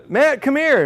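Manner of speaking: high-pitched call to an employee; yell. Retold or imitated. Boss summoning Matt to the office, retold yell. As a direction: re-enact hollering for someone down the hall. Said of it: "and I yell".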